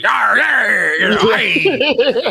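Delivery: playful pirate shout, dragged out. Retold or imitated. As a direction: yell it like a stage pirate. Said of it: "a pirate voice".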